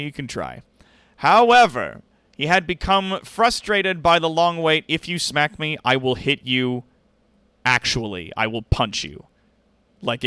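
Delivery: normal talking voice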